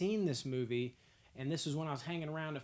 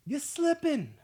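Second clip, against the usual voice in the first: you're slipping much higher and louder, slipping falling hard; fired-up callout; 12 semitones higher, 8 dB louder, slower than usual. Real, not acted. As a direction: shout slipping as a playful dig. Calling out viewers.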